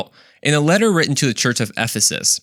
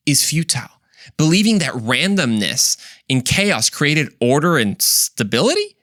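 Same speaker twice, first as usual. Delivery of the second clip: firm preacher voice; preaching; 2 semitones higher than usual. Acted. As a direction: declare each line with conviction. Preaching strong words.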